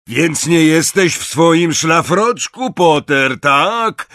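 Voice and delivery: loud, high, slow taunting yell; mocking shout. Death Eater taunts Potter about his dressing gown, shouting. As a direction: shout the jeer at the hidden boy. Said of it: "krzyknął śmierciożerca".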